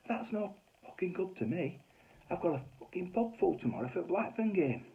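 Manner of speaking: high-pitched indignant complaint, not shouted; flipped landlady. Retold or imitated. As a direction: act out the landlady's outrage. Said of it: "And she just flipped".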